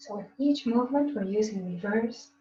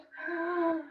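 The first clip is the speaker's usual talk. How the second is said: sharp startled intake of breath; scared gasp; 6 semitones higher, 4 dB quieter than usual. Shown as a demonstration. Teacher modelling scared inhale for the class. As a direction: gasp like something frightened you. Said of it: "inhaling again like you're scared".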